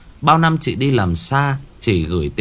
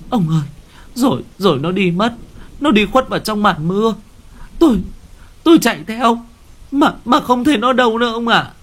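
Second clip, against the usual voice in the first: tearful wife; 12 semitones higher, faster than usual. Acted. Wife weeping to husband about her lost daughter, high and tearful. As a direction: cry it out to him, much higher. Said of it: "bà cứ thế mà ôm mặt khóc".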